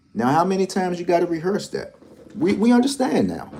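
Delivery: how many times, man, fed up and pleading; exasperated. Real, not acted. Ranting at a rival lottery YouTuber, high and exasperated. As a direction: pitch jumps way up in exasperation.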